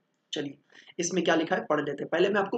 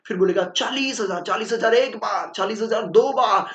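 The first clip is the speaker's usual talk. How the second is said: louder auctioneer chant, falling on each count; auctioneer calling bids; 3 semitones higher, 7 dB louder than usual. Retold or imitated. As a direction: call the bids like an auctioneer at the hammer. Teacher plays an auctioneer.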